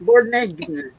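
Morninginen said as one long made-up word, mor-ning-i-nen; playful mispronunciation Morninginen; mock Welsh-sounding word. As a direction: stretch morning into Morninginen with a falling end. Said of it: "Sounds Welsh".

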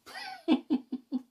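high laugh trailing off; giddy high giggles that die away. Acted. Laughs at own imagined scene.

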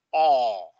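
"O" as long open aw; open-throat sound. Shown as a demonstration. Teacher showing how to do it, open vowel. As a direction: say "O" with mouth open, tongue down. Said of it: "le haces así".

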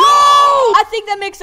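hammed-up slow shout; slow hyped shout. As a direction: belt it out slowly like a victory call. Exaggerated slow shouted take.

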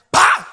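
a loud mock thud for the jump; loud landing thud. Robbers jumping off the fence, loud thud noise. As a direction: slam the sound of feet hitting the ground.

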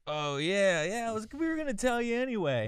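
high, loud tipsy turtle voice; hammed-up, high-pitched boozy confession; drunk talking turtle. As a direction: blurt it out like a drunken cartoon turtle.

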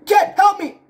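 high, urgent pretend plea; play-acted distress call; cry for help. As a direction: call out to your partner for rescue. Calling partner for rescue in play.

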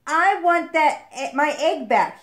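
put-on high, forceful demand from a boy; insistent shout; loud demand. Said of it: "he demanded".

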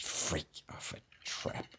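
whispered curse under the breath. Real, not acted. Gamer whispering to himself at a trap. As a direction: breathe it out, annoyed.

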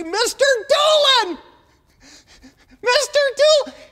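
high, loud shouted calls; calling out. Retold or imitated. Kids calling to the garbage man, loud shout. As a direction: yell his name across the street. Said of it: "we'd say".

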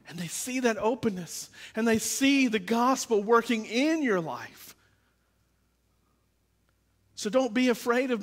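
high, impassioned pulpit voice; full-force preaching. Real, not acted. Pastor telling the congregation all are hypocrites, impassioned preaching.

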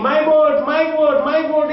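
high, insistent mock wail; imitated crying. Little boy crying over his sunken boat, imitated wail. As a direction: act a little boy's lament, without real sobs. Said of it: "this little boy started crying".

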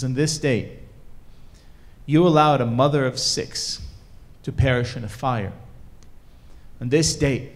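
loud, measured indictment at natural pitch; grave accusation. Acted. Shoemaker reading God a list of transgressions, gravely accusing. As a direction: lay each charge before God, pausing between phrases.

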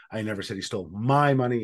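"my" leaned on, rest run quickly; stress on my. Shown as a demonstration. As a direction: make "my" stand out from the sentence. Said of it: "depending on which word you emphasize".

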